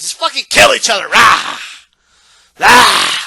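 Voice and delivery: fast, loud jeering roar; bay for blood from the crowd; bloodthirsty yell. Retold or imitated. Crowd member wanting death roaring at fighters, loud jeer. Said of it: "you just wanna see death".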